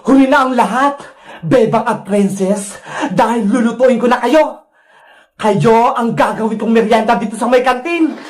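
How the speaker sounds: low villain voice with evil cackles; gloating wicked vendor. Acted. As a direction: gloat darkly and cackle at your captives. Villain pronounces their doom. (mababang boses ng masamang tindera, humahalakhak)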